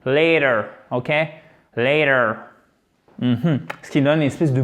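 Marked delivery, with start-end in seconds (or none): 0.1-0.6 "Later" with the T softened almost to a D; American flapped T. Shown as a demonstration. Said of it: "Le T devient presque un D". 1.8-2.4 "Later" with the T softened almost to a D; American flapped T. Shown as a demonstration. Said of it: "Le T devient presque un D".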